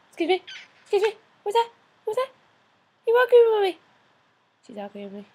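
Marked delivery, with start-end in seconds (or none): 0.1-1.1 louder, higher mock offence; mock indignant. Real, not acted. Owner to the pet, mock indignant louder. 1.4-3.8 high loud squeal; high excited pet talk. Real, not acted. Owner to the bird, high loud excited squeal.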